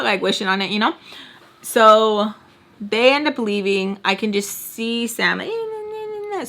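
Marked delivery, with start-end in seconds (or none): 5.4-6.5 high, soft mock nagging noise; squeaky, wordless imitation of yelling; imitated screaming. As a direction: mimic distant yelling as quiet high gibberish. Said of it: "screaming at her, yelling at her".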